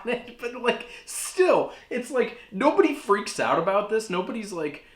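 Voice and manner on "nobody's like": ordinary talk, nothing marked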